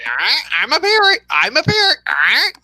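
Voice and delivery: high-pitched squawking parrot. Acted. Teaching an imagined parrot to say it, parrot voice. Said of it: "teach it is just, uh, just say".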